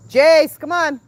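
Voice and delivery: loud high call across the yard. Real, not acted. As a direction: shout his name to fetch him. Calling son to come.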